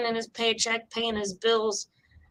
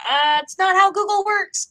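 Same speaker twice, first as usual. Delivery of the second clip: mock caller; 10 semitones higher, 4 dB louder than usual. Mocking caller's reply.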